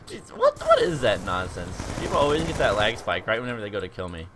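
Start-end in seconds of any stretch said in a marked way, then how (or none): 0.1-1.0 much higher, squeaky outburst; shocked squeak. Real, not acted. Gamer squeaking at a laggy kill, high and shocked. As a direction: squeak it out in disbelief. Lag spike frustration.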